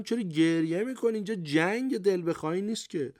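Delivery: lightly acted telling-off of a crying boy; mild scolding. A narrator's reading. Commander scolds a crying boy soldier.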